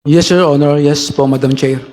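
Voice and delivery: ordinary talk, nothing marked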